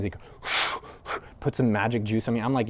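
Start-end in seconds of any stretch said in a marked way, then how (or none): 0.4-1.2 long audible breath blown out; blowing. Retold or imitated. Shaman trying to calm a panicked man, imitated blowing. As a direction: imitate a shaman's calming blow. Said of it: "tried to, like, do blow things".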